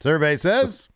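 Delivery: loud game show host call; Family Feud host. Pretend. Co-host parodying Family Feud, loud host call. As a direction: shout it like a quiz host.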